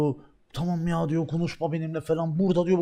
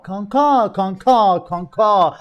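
louder repeated calls of kanka, each one falling; calling out; 4 semitones higher, 6 dB louder, much slower than usual. Retold or imitated. Friends calling kanka at him in a loud voice. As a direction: keep calling kanka to get his attention.

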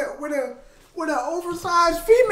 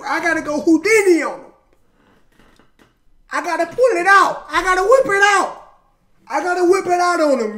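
loud, higher mock-urgent retelling; acted-out frantic resolve; 3 semitones higher, 5 dB louder than usual. Retelling the rapper's escape plan, mock-urgent. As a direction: push it louder and higher, playing desperate.

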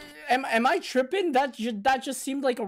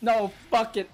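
ordinary delivery

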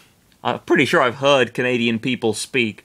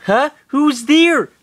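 put-on high girl voice; 13 semitones higher, 6 dB louder, slower than usual. Lynn voiced in read fiction.

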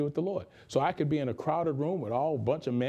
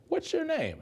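high, light stranger asking a name; lightly voiced curious stranger. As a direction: pitch it way up like a surprised stranger. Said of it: "the person looked at me and said".

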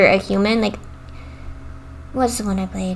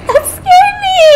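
scared laughing; 4 dB louder than usual. Real, not acted. Right after jump scare.

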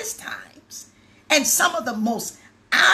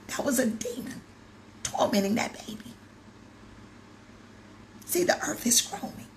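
preacher's whisper; 7 dB quieter than usual. Acted.

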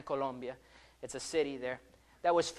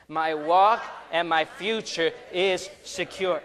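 louder, slower, emphatic declaration; like you mean it; 6 dB louder, slower than usual. Shown as a demonstration. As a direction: lead the congregation with full conviction. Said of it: "Now say it like you mean it".

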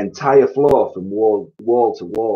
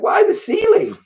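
shrieked, sky-high baffled question; astonished cry; 4 dB louder than usual. A narrator's reading. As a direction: shout it in shrill disbelief. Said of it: "they cried".

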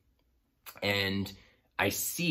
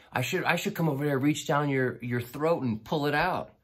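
higher, fast mock threat; playful threat; 8 semitones higher than usual. To the fish thief, higher fast half-joking threat. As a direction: bluster at him half-joking. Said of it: "being a jerk".

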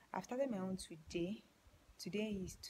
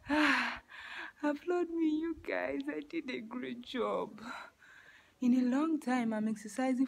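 excited; 5 semitones higher, 9 dB louder than usual. Real, not acted. Celebrating after exercise.

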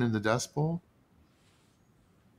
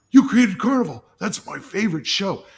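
high, eager fan voice; gushing fan; 7 semitones higher, 4 dB louder than usual. Retold or imitated. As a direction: gush like a starstruck stoner fan. Fans gush about show.

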